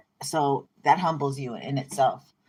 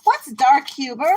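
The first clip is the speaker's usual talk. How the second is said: put-on a small child tugging at mom; 9 semitones higher, 6 dB louder than usual. Child voice asking mommy.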